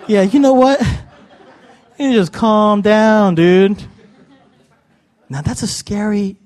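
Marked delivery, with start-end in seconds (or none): 0.1-3.8 casual dude reply. Acted. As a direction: stretch it out like a laid-back dude. Acts out a casual divine reply.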